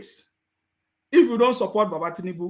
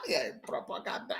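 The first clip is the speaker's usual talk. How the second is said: laughing; 11 dB quieter than usual. Real, not acted. Mocking caller, laughing.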